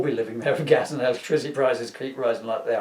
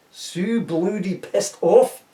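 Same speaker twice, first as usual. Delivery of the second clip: slow, louder, higher exasperated groan; mock sigh; 6 semitones higher, 5 dB louder, much slower than usual. A narrator's reading. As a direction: drag it out, fed up. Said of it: "sighed Jock".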